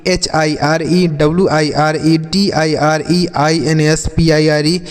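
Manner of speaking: ordinary delivery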